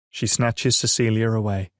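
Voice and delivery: plain reading voice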